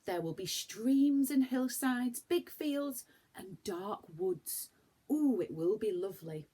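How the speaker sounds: lightly voiced boy, excited. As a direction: paint the picture with eager wonder. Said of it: "said Joe".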